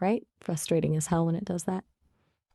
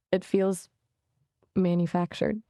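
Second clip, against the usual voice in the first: ordinary delivery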